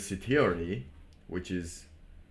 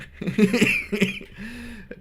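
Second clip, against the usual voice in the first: short laugh; 14 semitones higher than usual. Real, not acted. Laughs mid-sentence.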